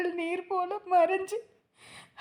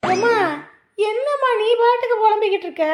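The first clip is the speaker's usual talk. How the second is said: higher, louder, fast complaint; loud whiny protest; 4 semitones higher, 9 dB louder, faster than usual. Acted. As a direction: fire it back quickly and loud. Daughter protests at mother's nagging.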